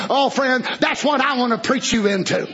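normal talking voice

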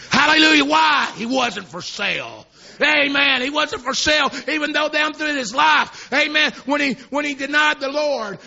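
high, loud preaching shout; fiery revival preaching; preaching at full force. Acted. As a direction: lift the congregation with a rising sermon cry.

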